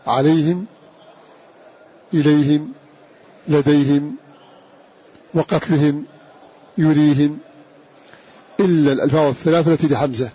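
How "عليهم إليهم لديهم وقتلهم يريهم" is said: عليهم إليهم لديهم وقتلهم يريهم all end in -him; each of عليهم, إليهم, لديهم read with an i, -him; kasra on the ha in pausal reading. Shown as a demonstration. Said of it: "بكسر هذه الهاء". (الوقف بكسر الهاء)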